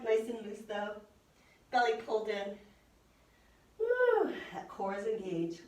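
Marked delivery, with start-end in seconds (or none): 3.8-4.3 high, loud coach whoop; cheering whoop. Real, not acted. Yoga teacher whooping at class holding plank, excited.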